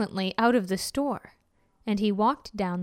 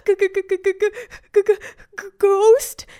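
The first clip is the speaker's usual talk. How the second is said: high, loud stutter exploding into the last word; terrified stammer; 9 semitones higher, 8 dB louder than usual. A narrator's reading. Shop girl sees the skeleton customer, terrified stammer. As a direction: stammer in rising panic until the word bursts out.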